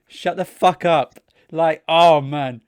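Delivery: loud, high, drawn-out exasperation; exasperated. Retold or imitated. Reacting to the woman thanking granddad, exasperated. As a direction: burst out in fed-up disbelief.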